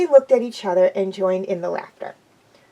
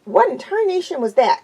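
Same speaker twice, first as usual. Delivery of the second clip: louder, higher startled yell; Applejack yelling; 7 semitones higher, 5 dB louder than usual. A narrator's reading. Applejack yelling after being jolted awake, loud. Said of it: "Applejack yelled".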